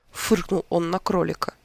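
ordinary delivery, nothing marked

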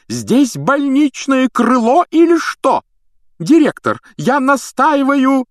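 very high, loud, slow protest; outraged outcry. A narrator's reading. Matron defending her hospital wing, outraged. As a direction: cry out indignantly. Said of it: "возопила мадам Помфри".

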